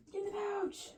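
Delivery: squeaky pleading voice; high, soft coaxing voice. Pretend. Squeezing pencils into pouch.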